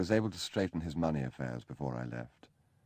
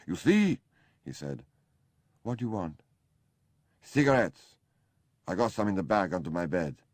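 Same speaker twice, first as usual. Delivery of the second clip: sudden high, loud demand; demanding Kurt; 4 semitones higher, 5 dB louder than usual. Acted. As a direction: bark for the servant who never comes.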